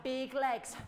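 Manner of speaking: ordinary talk, nothing marked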